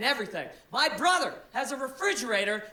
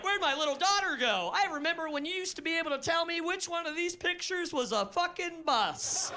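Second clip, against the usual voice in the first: mock crying; 6 semitones higher than usual. Father mourning his grown-up daughter, mock weepy and high. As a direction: whimper it like a heartbroken dad.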